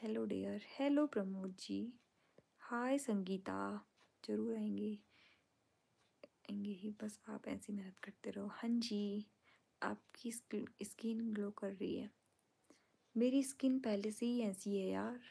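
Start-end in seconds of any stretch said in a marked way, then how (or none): 4.2-12.1 soft whisper reading fan comments late at night. Real, not acted. Streamer whispering viewers' comments aloud to her fans. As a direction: hush it, others are asleep.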